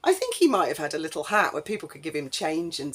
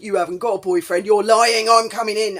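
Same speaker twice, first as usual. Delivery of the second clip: loud, menacing yell at her; aggressive shouting; 4 semitones higher, 10 dB louder than usual. Retold or imitated. Stranger at her door, shouting he's coming in. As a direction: bark it like you're barging in.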